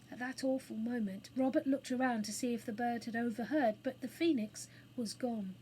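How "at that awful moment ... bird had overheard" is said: ordinary delivery, nothing marked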